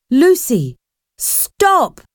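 high urgent shout of her name; shouting stop as she goes over. A narrator's reading. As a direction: yell to stop her from falling. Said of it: "he shouted".

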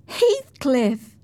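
put-on high-pitched, delighted young lady; excited girl. A narrator's reading. Cathy greets Heathcliff after weeks away, high excited voice. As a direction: call his name high and playful.

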